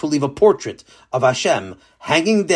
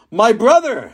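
higher, louder shocked cry; shocked exclaim; 8 semitones higher, 4 dB louder than usual. Acted. King recognising his twin, shocked and loud. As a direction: gasp it out in disbelief. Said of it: "the king exclaimed".

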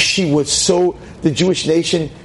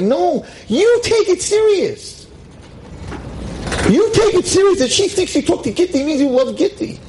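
high insistent retort. Retold or imitated. Teacher insisting the mother take her daughter seriously, high and pleading. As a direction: stretch it high and urgent. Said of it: "I'm like".

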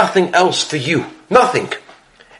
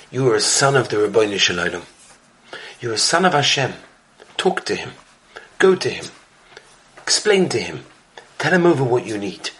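lower, softer and sincere; gentle counsel; 8 semitones lower, 5 dB quieter, faster than usual. Retold or imitated. Baba Sali comforts the broken man, soft and sincere. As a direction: speak low and kindly to a man in trouble.

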